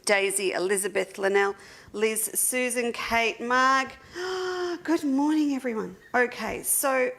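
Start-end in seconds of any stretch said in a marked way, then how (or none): 4.1-4.8 a sharp, high gasp of delight. Real, not acted. Host spots a favourite viewer in the chat, delighted gasp. 4.8-6.0 a high, bright, gushing good morning; excited greeting. Real, not acted. Host greets the live chat all at once, gushing hello.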